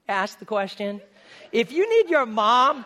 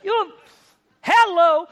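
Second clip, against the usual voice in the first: loud, high, incredulous mock scolding; 10 semitones higher, 8 dB louder than usual. Jesus jeering at the clueless disciples, loud and mocking. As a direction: jeer at them like they're dense.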